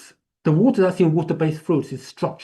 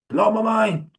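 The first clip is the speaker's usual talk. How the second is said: excited exclaim; 7 semitones higher than usual. Real, not acted. To the class about okra water, a high excited exclaim. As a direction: throw it out, thrilled.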